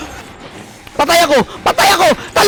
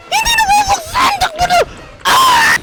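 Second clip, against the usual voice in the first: very high, frantic squeal; panicked screaming. Real, not acted. Player at a door that won't open, panicking. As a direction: shriek it while fleeing. Door stuck while being chased.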